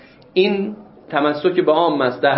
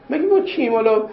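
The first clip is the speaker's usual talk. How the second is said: much higher, exclaimed مگه ما کیم as if amazed; rhetorical exclamation; 9 semitones higher than usual. Shown as a demonstration. Teacher reminding students of humility, high rhetorical exclamation. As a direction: toss the question up high in mock wonder.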